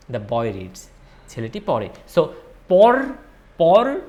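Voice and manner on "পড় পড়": পড় said loud and high, set off alone; verb root stressed. Shown as a demonstration. Teacher isolates the root of the verb for learners, stressed পড়. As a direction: punch out পড় as a lone root. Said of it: "is the root of the verb".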